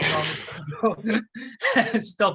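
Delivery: slow, laughing through the words. Real, not acted. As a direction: crack up at your own absurd suggestion. Laughs at own absurd idea.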